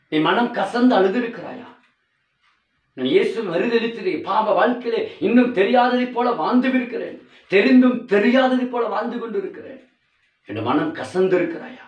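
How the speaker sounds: high, loud fiery sermon; preaching at full force. Acted. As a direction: thunder the warning at the congregation. (உரத்த பிரசங்கக் குரல்)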